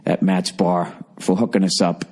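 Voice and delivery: ordinary delivery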